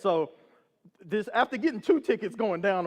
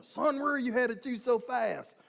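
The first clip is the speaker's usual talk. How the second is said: high, slow Oklahoma cop voice; imitated lawman questioning a driver, slow and high; Oklahoma policeman; 6 semitones higher than usual. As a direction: draw it out like an Oklahoma officer.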